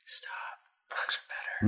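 hushed, voiceless whisper. Real, not acted. Podcast host sneaks in a defence of Porgs, whispered. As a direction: whisper it under your breath. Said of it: "I had to whisper that".

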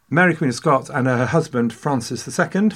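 ordinary talk, nothing marked

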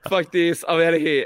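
cackling thief bolting; gleeful. Retold or imitated.

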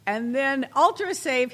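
normal talking voice

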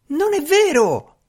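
very high-pitched protesting cry; indignant cry. A narrator's reading. Servant denying the magician's account, crying out. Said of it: "gridò".

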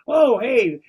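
high, loud exclamation; mock surprise. Acting out a spouse confronted with divorce papers, mock surprise. Acts out springing divorce news.

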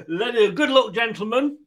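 high, loud good luck, gentlemen; rally the contestants to kick off; host's cheery send-off. Real, not acted. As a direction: quizmaster starting the game brightly. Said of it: "host announcing start".

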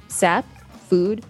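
usual speaking voice

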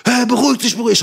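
high, loud imitation of someone calming him down; mock alarmed appeal, pitch leaping way up; agitated calming. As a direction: act out a frantic attempt to pacify. Other party calming him, acted.

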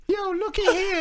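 high, put-on silly voice; goofy character voice. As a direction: play a cartoonish creep. Mocking a ginger character.

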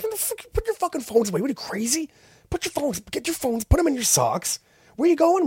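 high, mock-furious cop rant; an imitated cop berating naive tourists; agitated cop.

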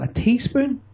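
high, baffled mock question; incredulous. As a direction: act stunned by something so small. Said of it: "you'll look at me going".

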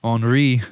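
Henri as "ahn-REE" with a nasal vowel; French pronunciation. Shown as a demonstration. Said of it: "so many different ways you can say Henry in a French accent".

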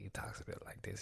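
very soft, whispered documentary voice; sexy voice. Acted. Imitating the documentary director's talk, hushed sexy voice. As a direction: drop to a near-whisper, imitating a sexy talker. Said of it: "he has a sexy voice".

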